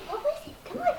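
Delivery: high, soft puppy coaxing; talking to a dog. Real, not acted.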